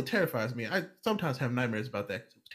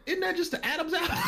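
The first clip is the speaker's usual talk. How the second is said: laughing disbelief. Retold or imitated. Retelling confused reply to doctor.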